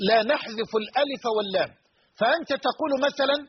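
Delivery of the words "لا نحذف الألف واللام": usual speaking voice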